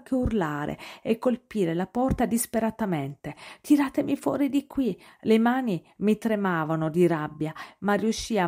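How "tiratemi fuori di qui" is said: trapped scream. A narrator's reading. Noah trapped, screaming in closet.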